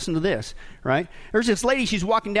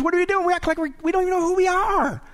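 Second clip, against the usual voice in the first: high, incredulous outburst; exasperated; 10 semitones higher than usual. Real, not acted. Preacher rebukes the church for forgetting itself, exasperated.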